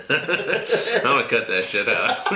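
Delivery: slow words squeezed through laughter; cracking up while talking, pitch lifted; laughing. Real, not acted. Cracking up after being teased.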